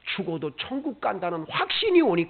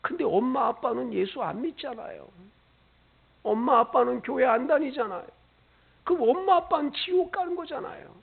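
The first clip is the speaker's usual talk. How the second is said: put-on crying child; 6 semitones higher than usual. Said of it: "그리고 막 우는 거예요".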